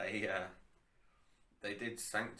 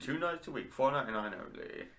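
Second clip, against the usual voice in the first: higher, louder mock ad pitch; commercial announcer; 6 semitones higher, 6 dB louder than usual. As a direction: keep selling like a cheesy ad. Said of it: "I got carried away".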